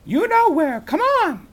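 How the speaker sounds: put-on high, loud girl voice calling out; calling Annie. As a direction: shout it back while running downstairs. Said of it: "Annie called".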